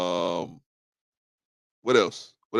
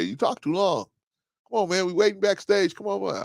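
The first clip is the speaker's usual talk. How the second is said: high-pitched mimicked griping; mock complaint; 10 semitones higher than usual. Guests backstage complain to host he talks too long, mock griping. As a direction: nag in a raised, put-on voice.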